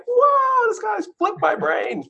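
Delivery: whoa shot way up high, then slow disbelief; huge high whoa, then drawn-out amazement; stunned whoa. Retold or imitated. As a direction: sell being totally floored by the news. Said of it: "and I'm like".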